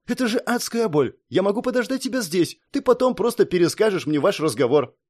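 high, anxious pleading; alarmed. Acted. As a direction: talk him out of danger. Princess frightened pleading.

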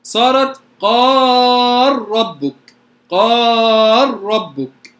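قال ربك very slow, lam fully merged into a doubled ra, said "qarrabbuk". Shown as a demonstration. Teacher shows students how قال ربك sounds with the lam merged into ra. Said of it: "فلما أدغم".

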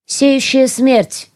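very slow, high dramatic words; slow ominous reveal. Acted. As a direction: announce your deadly name slowly. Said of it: "призналась я". (медленно и зловеще)

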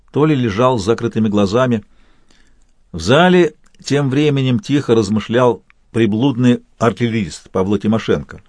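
plain reading voice

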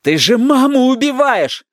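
high, slow, near-tearful cry; acted distraught grandson; anguished accusation. A narrator's reading. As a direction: cry it out in desperate reproach.

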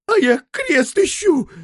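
a surprisingly thin, piping voice; thin little voice. A narrator's reading. Coachman answering in an unexpectedly thin voice. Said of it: "неожиданно тонким голоском".

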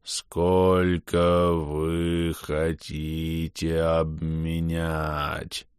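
very slow, eerie distorted voice. Acted. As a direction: eldritch horror, drawn out slowly. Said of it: "жутко искажённый голос".

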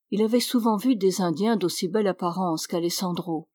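ordinary delivery

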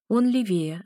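usual speaking voice

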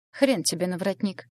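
ordinary delivery

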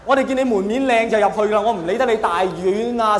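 ordinary talk

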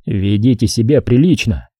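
usual speaking voice

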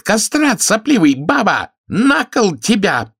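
high, loud shrieked insults; shrill woman's tirade, rising to a drawn-out curse; furious yelling. A narrator's reading. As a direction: scream abuse after a fleeing lover. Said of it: "крикнула она ему вслед".

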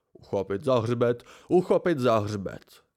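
lightly growled imitation of a nagging archivist; growled mimicry. A narrator's reading. Friend mimicking Loren's book-handling rule, growled mimicry. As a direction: repeat it as a pedant's rule, drawing out the repeat. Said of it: "Zavrčel a naznačil oběma rukama".

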